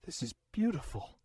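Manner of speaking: hushed, admiring murmur; awed. Acted. Warrior admiring a battle axe, awed. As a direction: marvel at it softly.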